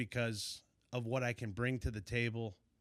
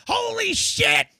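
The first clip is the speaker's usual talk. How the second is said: high, full-force imitation of a friend's scream; high-pitched excited holler, drawn out; retold scream; 16 dB louder, slower than usual. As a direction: scream it like he just hooked a monster. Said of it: "he's screaming again".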